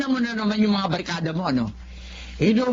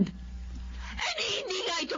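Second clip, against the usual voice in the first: tearful, breaking voice, a bit softer; crying mother; 8 semitones higher, 10 dB quieter, slower than usual. Acted. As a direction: cry through the words, the money isn't enough. Mother crying over too little money. (umiiyak na ina)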